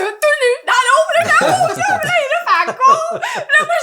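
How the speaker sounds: high, loud, laughing telling; excited. Real, not acted. Storyteller laughs while describing friend running naked. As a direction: tell it bursting with laughter. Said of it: "laughing retelling".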